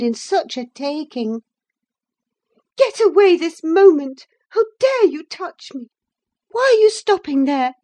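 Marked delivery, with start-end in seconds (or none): 2.8-7.7 high, indignant outburst of disgust; disgusted cry. A narrator's reading. Woman cries at the cousin stroking her curl, in disgust. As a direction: recoil from the touch in raised voice. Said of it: "cried in a tone of disgust".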